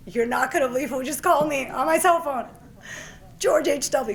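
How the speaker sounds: high, loud bursting announcement; telling her brother who just phoned; excited news. Retold or imitated. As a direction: blurt out the big news.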